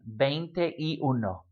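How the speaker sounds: slow veinte y uno, three separate words; broken into words. Shown as a demonstration. Teacher showing you can breathe mid-number, slow separate words. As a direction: spell out veinte, y, uno slowly with gaps. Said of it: "stop and breathe in the middle".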